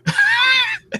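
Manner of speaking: a sudden high-pitched laughing squeal; high squeal of laughter. Real, not acted. Telling a mod story, squealing laugh.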